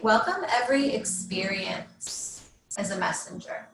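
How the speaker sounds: measured meditation guide, long pauses between phrases; guided meditation. Real, not acted. Yoga teacher settling students into Yoga Nidra, guided meditation. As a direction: guide them gently into rest, leaving space after each instruction.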